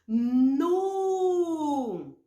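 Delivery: Nu held long and clear; syllable demo. Shown as a demonstration. Teacher models Nu for the class. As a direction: sound out Nu slowly. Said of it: "Vamos ver, então, juntas?".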